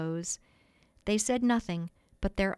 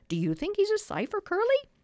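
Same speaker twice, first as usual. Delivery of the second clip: eager question; 12 semitones higher, 3 dB louder than usual. A narrator's reading. Boys eagerly polling each other.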